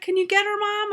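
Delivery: put-on high-pitched pleading kid voice; upset child, imitated. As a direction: mimic a distressed daughter begging mom for help. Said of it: "she's clearly upset and she's crying".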